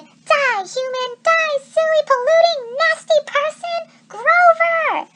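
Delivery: high, loud frenzied shouting; shrill battle cry at the screen, slow on the insult; playing satyr yelling at virtual hunters; game-crazed satyr. A narrator's reading. Grover lost in hunter game.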